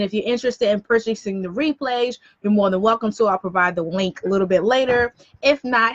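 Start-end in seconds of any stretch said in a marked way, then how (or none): none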